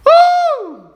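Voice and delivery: very high loud church woo; ecstatic whoop. Real, not acted. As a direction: let out a joyful woo. Preacher's ecstatic cry.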